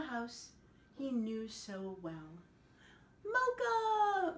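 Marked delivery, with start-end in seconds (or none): 3.2-4.4 high, loud call of the dog's name; joyful shout. A narrator's reading. Michelle the girl seeing her dog come home, joyful shout. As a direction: call out to him across the yard. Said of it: "Michelle shouted".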